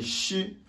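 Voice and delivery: chi with a Mandarin sh, high; chi as the Chinese letters sh; Chinese sh sound. Shown as a demonstration. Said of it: "Et le S H".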